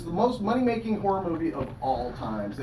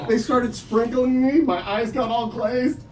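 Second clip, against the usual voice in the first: slow, higher, louder build; slowed punchline; 7 semitones higher, 6 dB louder, slower than usual. Real, not acted. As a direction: savor the pun. Building donut pun punchline.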